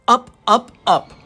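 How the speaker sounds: usual speaking voice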